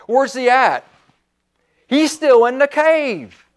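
high, forceful preaching; fired-up preaching. Real, not acted. Preacher urging the saints to get radical, fired-up preaching.

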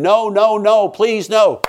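high, loud, desperate begging; reenacted scream for mercy; retold screaming plea. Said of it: "And I'm screaming".

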